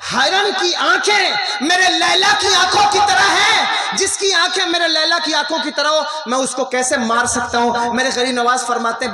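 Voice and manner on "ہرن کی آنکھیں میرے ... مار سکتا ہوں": high, loud dramatic declamation; raised, ringing storyteller's voice; impassioned retold cry. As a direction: cry out Majnun's answer to his friends. Majnun's impassioned reply, retold.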